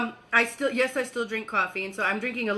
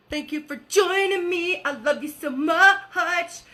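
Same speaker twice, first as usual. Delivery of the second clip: affectionate thanks; 6 semitones higher, 3 dB louder than usual. Real, not acted. Thanking viewers as they join.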